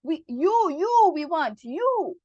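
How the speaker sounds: you lifted high and loud; stress on you. Shown as a demonstration. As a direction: push the final you. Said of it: "it creates stress".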